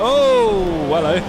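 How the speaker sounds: loud, high startled greeting; surprised. Real, not acted. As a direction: jump at the sudden car. Car suddenly alongside.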